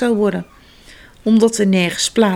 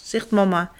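usual speaking voice

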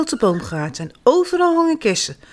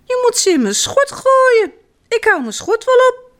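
put-on very high-pitched little girl's call; 4 dB louder than usual. A narrator's reading. Janneke calling up to Jip about apples, calling out. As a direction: call instructions up into the tree. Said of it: "roept Janneke".

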